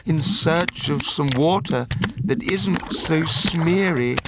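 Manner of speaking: the Lorax tells off the factory owner; scolding Lorax voice. Acted.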